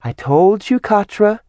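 high, lilting sing-song; sing-songy. A narrator's reading. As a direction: tease it in a sing-song lilt. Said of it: "Simi said in a sing-songy voice".

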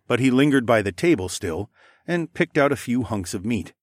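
plain reading voice

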